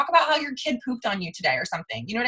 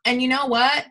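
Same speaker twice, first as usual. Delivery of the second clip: ordinary talk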